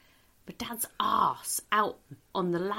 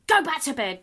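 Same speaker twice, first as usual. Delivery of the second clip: higher, louder mock shout; mum shouting; 8 semitones higher, 6 dB louder than usual. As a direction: bark it at the kid upstairs. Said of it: "my mum shouting at me".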